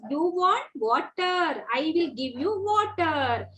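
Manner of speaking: slow, lilting, word by word; broken-up words. Shown as a demonstration. Teacher models offering a child water, broken-up words. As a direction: stretch each word for a small child. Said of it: "break the words".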